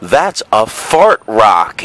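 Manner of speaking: put-on slow, knowing kid voice; kid's claim. Kid telling the gullible boy about fart rocks. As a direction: lay it out like a know-it-all kid. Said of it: "one of the kids goes like this".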